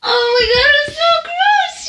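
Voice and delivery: high, squealed disgust through laughter; disgusted laughing squeal. Real, not acted. Laughing in disgust at own story.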